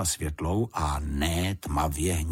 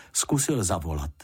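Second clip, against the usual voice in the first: ordinary delivery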